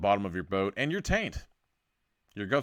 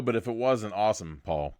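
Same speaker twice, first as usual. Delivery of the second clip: ordinary delivery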